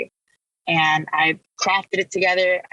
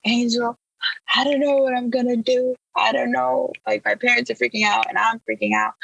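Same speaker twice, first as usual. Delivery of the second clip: lightly imitated tearful plea, higher pitch; retold sobbing plea; 6 semitones higher than usual. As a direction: replay the crying outburst, pitched higher. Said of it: "just sobbing my eyes out".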